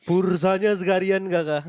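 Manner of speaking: put-on slow Japanese-accented robotic cashier; cheerful AI cashier, L as R; chipper text to speech voice. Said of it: "A I Jepang gitu".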